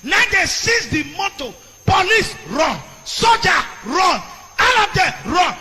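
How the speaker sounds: loud, high outraged sermon; fiery preaching. Acted. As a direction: rail at the governor from the pulpit.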